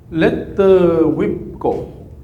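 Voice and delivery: long drawn coaching call; drawn-out cue. Shown as a demonstration. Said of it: "the last one".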